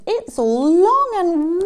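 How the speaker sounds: high, loud, drawn-out wonder; amazed elephant. Acted. Elephant examining his stretched nose, amazed. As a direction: marvel slowly at it.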